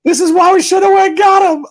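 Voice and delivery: loud, high-pitched mock fan outcry; Brinson impression. Guest redoing his Jets fan bit for laughs, Brinson impression. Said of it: "It was my Brinson voice".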